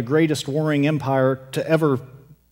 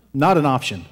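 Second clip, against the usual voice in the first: ordinary delivery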